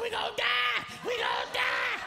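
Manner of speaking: panicked prayer leader on a plunging plane, high-pitched scream. Retold or imitated. Prayer leader screaming on the falling plane, screaming. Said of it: "she's screaming".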